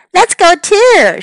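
high, loud eager shout; loud children's cry. Acted. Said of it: "shouted Topsy and Tim".

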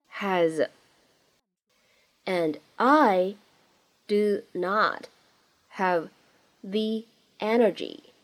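one isolated word at a time. Shown as a demonstration. Teacher modelling the line for learners, word by word. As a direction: say each word separately with long pauses.

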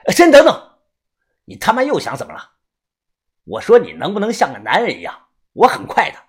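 loud desperate shout. Acted. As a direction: yell to make them stop. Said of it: "我立即大喊". (大声喊叫)

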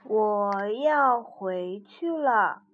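slow, resolute bear. Acted. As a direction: say it slowly and firmly. Bear says slowly leaving.

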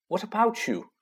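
a hint of a worried girl asking; Hermione's voice. A narrator's reading. Hermione asking Ron where he will go, lightly voiced girl.

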